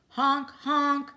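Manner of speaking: loud, high car horn honks; honking horns. Acted. Reader imitating the speeding cars' horns. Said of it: "went the horns on the speeding cars".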